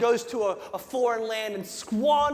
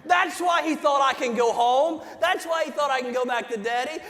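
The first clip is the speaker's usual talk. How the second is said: preaching at full force; 4 semitones higher than usual. Acted. Pastor retelling the prodigal son to his church, shouting with fervor. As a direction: preach it big, like you're lifting the whole room.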